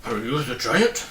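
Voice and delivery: a touch of doubt in the question; mildly doubtful dog asking the door. A narrator's reading. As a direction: ask it unsure he's really a giant. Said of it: "asked Tok doubtfully".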